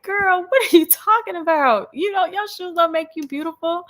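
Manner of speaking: acted baffled mom setting a child straight; mock exasperation. Mom answering daughter's shoe worry, exasperated retold reply.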